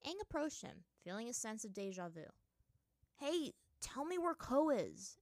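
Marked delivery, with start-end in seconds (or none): 3.2-5.2 loud, slow, higher-pitched demand. A narrator's reading. Aang confronts the monkey spirit, demanding. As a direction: press hard for an answer, raised voice. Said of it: "Aang demanded".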